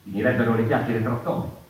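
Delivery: a ringing stage proclamation; loud proclamation. Acted. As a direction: declaim it loud and high. Declaiming after long pause.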